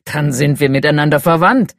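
loud, hearty booming giant. Acted. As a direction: boom it out like a bear of a man. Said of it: "erklärte er mit dröhnender Stimme".

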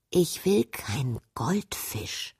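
a soft, breathy whisper of defiance; quiet refusal. Acted. Princess refusing the goldfish her mother offers, whispered sulkily. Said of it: "sagte P F leise".

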